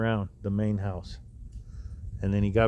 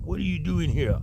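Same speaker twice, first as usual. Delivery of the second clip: put-on high, heavy Russian accent; 8 semitones higher than usual. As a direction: bark it in a thick Russian accent. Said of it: "with a real heavy Russian accent".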